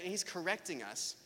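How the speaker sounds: ordinary talk, nothing marked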